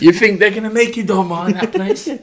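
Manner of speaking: high, teasing mum voice; mock mum. Mum tempting her son to stay with dolma. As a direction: tease like a mum offering dolma. Mocking mum's tempting line.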